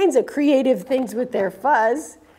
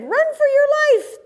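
high, frantic squeal of alarm once more; panicked cry; 4 dB louder than usual. Acted. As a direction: shriek it like a scared little critter. Prairie dogs flee again.

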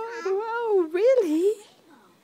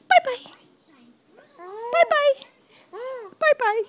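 put-on baby-talk bye-bye; 2 semitones higher than usual. Shown as a demonstration.

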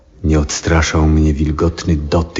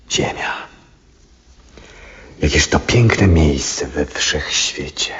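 slow, hushed whisper of wonder; whispered to himself, slow and amazed; awed whisper. Acted. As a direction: breathe it out, gazing at the earth. Old man gazing at the earth in awe.

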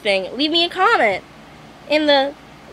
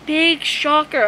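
sarcastic; 3 semitones higher, slower than usual. Acted. Sarcastic after mock gasp.

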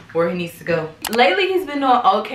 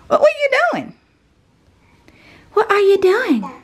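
much higher, playful alarm; 13 semitones higher, 5 dB louder than usual. Real, not acted. Mom to baby pulling himself up, high playful alarm. Baby grabbing at unsteady object.

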